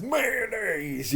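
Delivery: drawn-out, high-pitched sketch punchline; James. Retold or imitated. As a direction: stretch the word out in a silly high voice. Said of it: "James comes out at the end and says".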